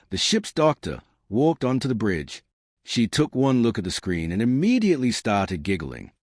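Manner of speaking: usual speaking voice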